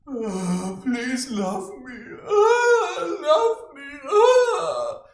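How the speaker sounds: high, loud, crying beg for love; mock wailing plea. Mocking needy people, wailing high and loud. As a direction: whine it out like a needy crybaby. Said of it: "people are like this".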